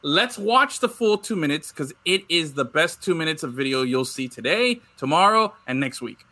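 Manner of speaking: ordinary delivery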